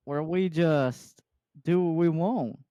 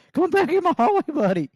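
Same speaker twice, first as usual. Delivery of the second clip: high mock voice; 13 semitones higher, 7 dB louder than usual. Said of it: "Kyle's just like".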